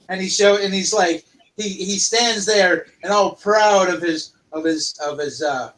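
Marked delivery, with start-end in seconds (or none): none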